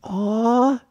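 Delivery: high hushed coo. A narrator's reading. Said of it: "cooing a hushed".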